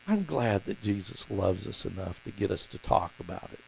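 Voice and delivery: ordinary talk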